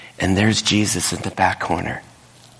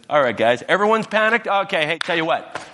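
casual Jesus; 8 semitones higher than usual. Retold or imitated. Jesus voiced casually in retelling.

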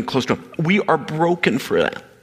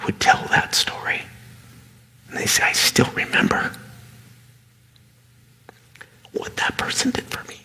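hushed; 6 semitones higher, 3 dB quieter than usual. Real, not acted. Preacher hushing the congregation, soft and whispered. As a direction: drop to a near whisper.

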